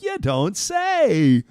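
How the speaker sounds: slow, drawn-out sarcasm; deadpan mock surprise; slow sarcastic you don't say. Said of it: "You're like".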